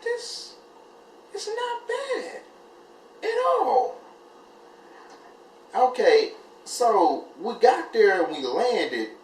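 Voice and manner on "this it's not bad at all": much higher, breathy, slow; high airy relief. Retold or imitated. A relieved flyer finding turbulence mild, high airy surprise. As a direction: float it out in pleased disbelief.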